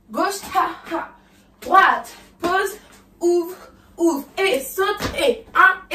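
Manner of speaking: high, loud called cues; calling the choreography. Acted. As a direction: call each move on the beat. (annoncer les pas fort et haut)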